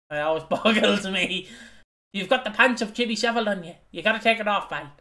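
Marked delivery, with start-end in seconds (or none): 2.1-4.9 put-on lightly done fast high Dublin accent; a hint of a high-pitched Dublin accent. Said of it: "in a very big Dublin accent".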